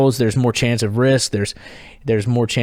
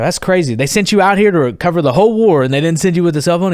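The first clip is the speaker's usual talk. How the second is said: high, louder disbelief; incredulous; 6 semitones higher, 4 dB louder than usual. Retold or imitated. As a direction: retell your baffled challenge to the reporter. Retold disbelief at reporter's answer.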